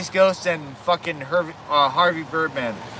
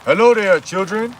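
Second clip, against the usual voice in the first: louder, higher cartoon greeting; Chef; 4 semitones higher, 3 dB louder than usual. School cook greeting the children, put-on character voice. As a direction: sing out a big hello to the kids. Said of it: "Hey, Chef".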